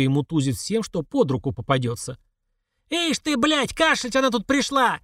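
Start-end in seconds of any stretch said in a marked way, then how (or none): 2.9-5.0 strained, indignant growl with raised pitch; growling old woman. A narrator's reading. Old woman in the queue attacking a cougher, growling. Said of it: "басовито рыкнула старуха из толпы".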